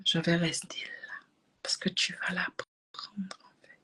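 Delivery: plus doux, soufflé, insistant; insistance chuchotée. Retold or imitated. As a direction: insister tout bas. Insiste tout bas auprès du médecin. (insistance soufflée auprès du médecin)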